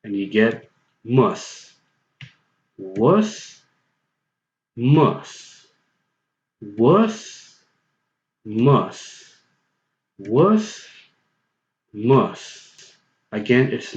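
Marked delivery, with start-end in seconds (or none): 1.0-1.7 mus said slowly with the short u of wuss; slow mus, same vowel as wuss; pronounce mus. Shown as a demonstration. Teacher shows the viewer the new word mus, slowly. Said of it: "replace the W with an M and keep everything constant". 2.8-12.9 wuss then mus, back and forth, same vowel; wuss and mus drill. Shown as a demonstration. Teacher drills the viewer on wuss and mus. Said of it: "keep everything constant".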